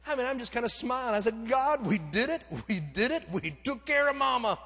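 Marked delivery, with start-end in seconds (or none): 1.5-4.6 high, loud, jubilant thanks; elated relief. Retold or imitated. Breathless traveller thanking God after making the flight, in a high, loud, elated voice. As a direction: celebrate it out loud, pitched up.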